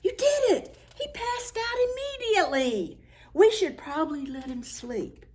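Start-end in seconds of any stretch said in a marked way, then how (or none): none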